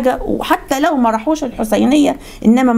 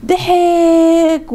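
ضحك stretched long on one high note; drawn-out emphasis; 8 semitones higher, much slower than usual. Retold or imitated. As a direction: hold the vowel of ضحك, pitched high. Retelling Muawiya's laugh, word stretched. (مدّ كلمة ضحك بنبرة عالية)

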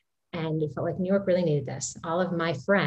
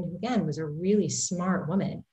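usual speaking voice

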